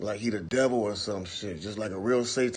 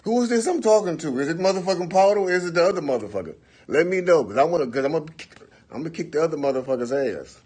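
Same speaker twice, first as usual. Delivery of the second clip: much higher, louder, fast angry yelling; furious; 8 semitones higher, 6 dB louder, faster than usual. Retold or imitated. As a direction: confront him hard. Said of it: "I say".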